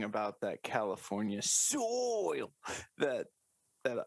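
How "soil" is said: SOIL stretched long, swooping high then falling; milk the word "soil" with a high, slow swoop; drawn-out SOIL. Acted. Teasing co-host about California, drawn-out soil.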